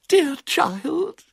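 put-on very high elderly cousin voice; affected, high-pitched old lady; gushing murmur. A narrator's reading. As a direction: coo it at the child in a high voice. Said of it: "she murmured".

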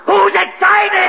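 high, drawn-out frantic shout; overexcited shout. Acted. Boss denying to Kenny that he's excited, frantic shout. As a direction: shout the denial, wildly worked up. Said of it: "What's everybody so excited about?".

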